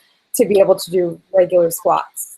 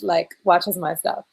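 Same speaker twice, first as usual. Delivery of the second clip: usual speaking voice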